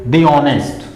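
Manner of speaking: "the" said dee, linked into honest; "the" as thee. Shown as a demonstration. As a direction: say "the honest" as dee-onest, silent h. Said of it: "तो कैसे pronounce करेंगे".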